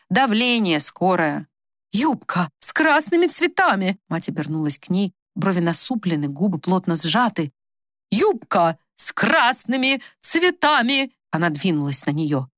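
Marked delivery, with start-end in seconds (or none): 1.9-4.0 high, slow, insistent exclamation; agitated demand. A narrator's reading. Agitated mother demands her skirt. 8.1-11.1 high, drawn-out, word by word; insistent demand. A narrator's reading. Mother repeats demand, brows knitted.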